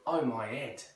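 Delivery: Cockney, "head" without its H; East London accent. Shown as a demonstration. Example of dropped H, East London accent. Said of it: "they tend not to pronounce it".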